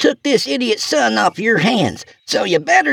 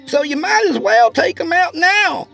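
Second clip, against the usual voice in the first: very high, sardonic called-out speech; shouting to the cabin. A narrator's reading. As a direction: call it out high toward the cabin. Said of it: "Lorna shouted in the cabin's direction".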